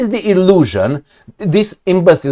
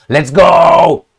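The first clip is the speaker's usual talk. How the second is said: mock shout; 4 dB louder than usual. Daddy's home with belt scene.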